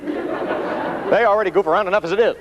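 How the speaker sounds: a hint of an anxious boss hissing a warning; worried manager. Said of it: "and you're going".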